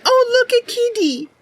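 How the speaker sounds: high cooing at a cat; mock cutesy coo. Streamer imagining her character cooing at a cat, high. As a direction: coo like spotting a kitten. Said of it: "If I went over and I went".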